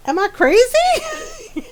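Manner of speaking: high, laughing "am I crazy"; laughing disbelief. Retold or imitated. Asking friends about the blanket colour, laughing in disbelief. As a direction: ask it while cracking up. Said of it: "I was just like".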